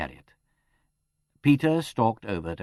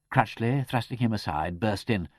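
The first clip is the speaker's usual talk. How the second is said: ordinary delivery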